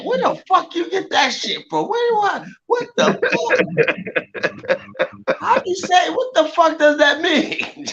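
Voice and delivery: baffled outrage. Retold or imitated. Friend acts out confusion at the pimp rhyme, pitched high. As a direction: squeal in disbelief at the nonsense.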